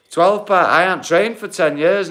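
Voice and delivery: ordinary talk, nothing marked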